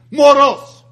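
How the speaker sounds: loud, high sneer on "Moros"; scornful insult. Retold or imitated. Preacher voicing a brother's insult, loud scorn. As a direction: hurl the word with contempt.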